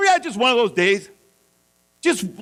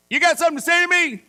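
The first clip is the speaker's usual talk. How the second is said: fast, higher challenging yell; retold yell; 7 semitones higher, faster than usual. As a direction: snap it back at the biker, fast and high. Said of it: "And so I yell".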